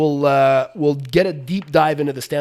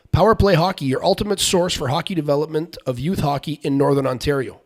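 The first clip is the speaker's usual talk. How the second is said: punchy, projected announcer read; sponsor ad read; 4 semitones higher, 5 dB louder than usual. Acted. Host pitching hockey training to fans and future stars, energetic ad read. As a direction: sell it briskly to the listeners, pitched up a little.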